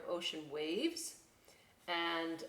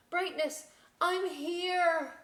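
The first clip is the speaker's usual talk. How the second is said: calling out; 11 semitones higher, 7 dB louder, much slower than usual. Acted. Lighthouse calling to boat.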